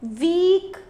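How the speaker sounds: वीक with the ई held long; long vowel. Shown as a demonstration. Teacher showing children the long sound, वीक drawn out. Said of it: "ई का long sound आ रहा है".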